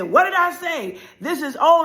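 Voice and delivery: loud, high motherly scolding; acted mother's angry outburst. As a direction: lay down the law loudly.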